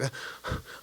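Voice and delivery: a sudden, breathless unvoiced gasp; sharp gasp. Fighter mimicking the gasp of his opponent after the spit, sharp gasp. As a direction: suck air in sharply through the mouth.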